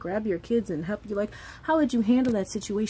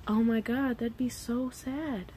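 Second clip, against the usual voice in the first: usual speaking voice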